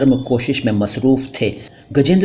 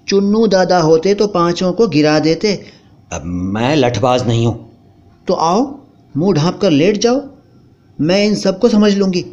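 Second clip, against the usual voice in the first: higher, mocking wife's voice; wife sarcastically mocking her husband; scornful wife; 6 semitones higher than usual. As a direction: taunt the timid husband. Wife's voice again.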